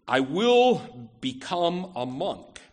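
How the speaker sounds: slow, emphatic mock vow, pitch jumping on "will"; quoted oath, drawn out word by word; retold cried-out vow. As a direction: lightly declaim it as a solemn pledge, stretched out. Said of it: "Luther cried out".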